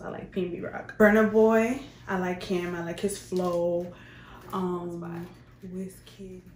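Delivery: usual speaking voice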